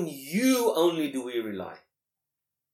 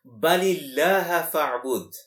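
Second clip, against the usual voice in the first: fast chanted Arabic recitation, louder; louder recited Arabic verse; Quran recitation; 3 semitones higher, 6 dB louder, much faster than usual. Shown as a demonstration.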